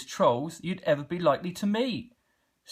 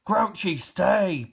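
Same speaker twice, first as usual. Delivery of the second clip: mild pant; 7 semitones higher, 5 dB louder, much slower than usual. Acted. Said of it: "panted the Grunt".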